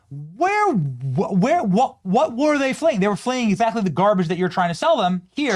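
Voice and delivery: high, loud, exasperated shouting; incredulous rant. Real, not acted. Vlogger yelling back at AOC's speech, high and loud. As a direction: demand an answer, voice cracking up high.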